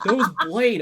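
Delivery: loud, high excited call. Retold or imitated. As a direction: announce it with excitement. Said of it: "everyone was like".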